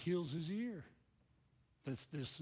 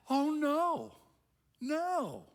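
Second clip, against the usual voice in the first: high, loud cry of dismay; a stricken, sinking no; dismayed Peter; 13 semitones higher, 8 dB louder, much slower than usual. Acted. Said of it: "he was into it and going".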